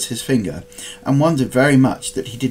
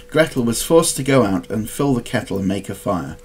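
ordinary delivery, nothing marked

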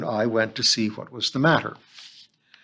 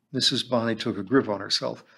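ordinary delivery

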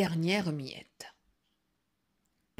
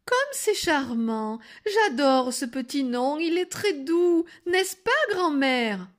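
exaggerated sweetness; 7 semitones higher, 6 dB louder than usual. A narrator's reading. Wolf praises the nickname to grandmother, sugary delight. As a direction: coo with put-on rapture. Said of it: "prit sa voix la plus douce".

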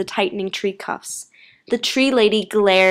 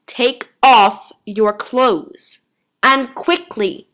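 very slow, emphatic, loud command; urgent order; 4 semitones higher, 6 dB louder, much slower than usual. A narrator's reading. Unseen voice instructing the girl, slow urgent command. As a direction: spell out the order, word by word. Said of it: "the voice hissed urgently".

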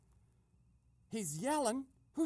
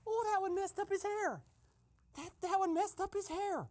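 high-pitched, tickled reaction; gleeful high squeal; 8 semitones higher than usual. Pretend. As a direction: squeal it high, enjoying the prank. Gleeful after the prank call.

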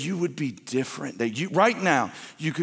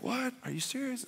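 mock surprise; 11 semitones higher, 11 dB quieter than usual. Voicing the Trinity's surprise.

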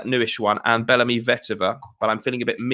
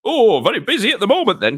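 much higher, louder wry host quip; Jim Bowen; 11 semitones higher, 8 dB louder than usual. Retold or imitated. Host joking back to the contestant, high and loud. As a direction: land the joke like the cheeky host. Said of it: "he said".

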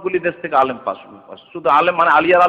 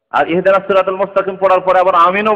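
rising preaching; 6 semitones higher, 7 dB louder than usual. Real, not acted. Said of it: "preaching at full force".

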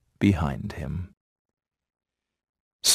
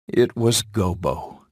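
usual speaking voice

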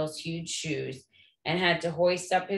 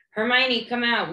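higher and louder, calling through a door; 7 semitones higher, 5 dB louder than usual. Acted. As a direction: shout for her through the stall door. Ron hammering on stall door.

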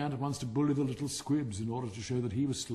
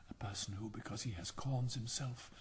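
hushed, much softer aside; low whisper; 9 dB quieter than usual. A narrator's reading. Roman candle answering the cracker, low whisper. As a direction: confide it under your breath. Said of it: "in a low whisper".

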